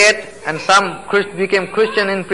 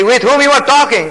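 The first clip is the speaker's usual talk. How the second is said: high, loud challenge from the guard; guard's challenge; 8 semitones higher, 4 dB louder than usual. Retold or imitated. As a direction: call out like a suspicious guard.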